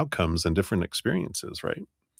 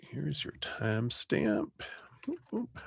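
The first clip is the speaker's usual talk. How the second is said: whisper; 5 semitones higher, 11 dB quieter than usual. Real, not acted. Noting the time quietly to herself, whispered. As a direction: breathe it as a private aside.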